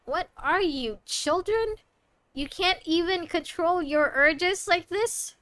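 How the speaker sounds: exasperated, disbelieving rebuke; mock scolding. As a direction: scold them like naughty children. Scolding the game characters.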